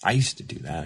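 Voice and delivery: ordinary talk, nothing marked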